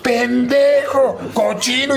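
regaño agudo y lento, arrastrando las palabras; mamá furiosa regañando al hijo. Retold or imitated. (mamá regaña a gritos al hijo: voz aguda y lenta)

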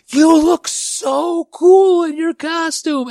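high, drawn-out holler; yelling across the street. Retold or imitated. Neighbor to trick-or-treating kid, yelling across the street. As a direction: call out slowly and high to someone far off. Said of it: "yelling across the street".

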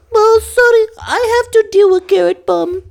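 mock sweet. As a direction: parody her syrupy sympathy. Mocking Lana, much higher.

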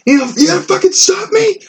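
high-pitched imitation of an irate man yelling; mock screaming. Man yelling at store worker who blocks his way, mock screaming. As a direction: squeal it like a raging man, far above your own voice. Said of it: "yelling at me".

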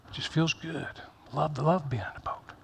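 whispered, dreamy about the boat. Acted. Pastor teasing the church's love of comfort, whispered. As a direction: breathe it like a secret pleasure.

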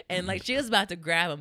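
ordinary talk, nothing marked

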